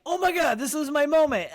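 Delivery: high, eager exclamation; excited. Retold or imitated. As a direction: burst out at the big chance. Seizing the DJ chance.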